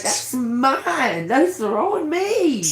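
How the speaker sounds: high, slow amazed wonder. Retold or imitated. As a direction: marvel at your own wings. Amazed at seeing own wings.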